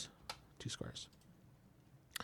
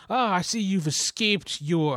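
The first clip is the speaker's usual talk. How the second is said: put-on high, slow Count Dracula accent; Transylvanian accent; 6 semitones higher than usual. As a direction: sneer it like a vampire count. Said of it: "my Lawrence voice like Corey, of the Count voice".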